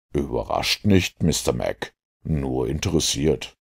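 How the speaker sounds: low, soft, unruffled detective voice; composed Holmes. Acted. Holmes explains to the inspector why he isn't surprised, composed. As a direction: keep it low and coolly interested. Narrator plays Holmes, unsurprised.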